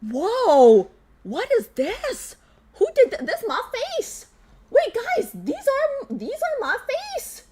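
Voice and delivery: high, surprised delight; wide-eyed squeal; amazed. Real, not acted. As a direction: gasp at seeing your own face. Seeing her face in fan art.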